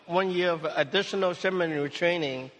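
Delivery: ordinary talk, nothing marked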